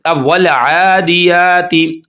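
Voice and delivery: वल आदियाती slowly drawn out; slow tajweed reading; correct recitation. Shown as a demonstration. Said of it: "आदे आते नहीं पढ़ेंगे".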